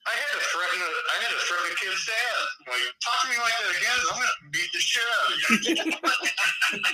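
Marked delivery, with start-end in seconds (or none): 3.0-6.9 mock threatening shout. To a kid's dad on the sideline, a laughing mock threat.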